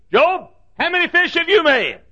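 high, booming question to Job; God taunting Job from above; God's challenge. Acted. God voiced asking Job.

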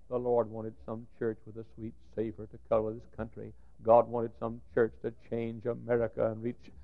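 ordinary talk

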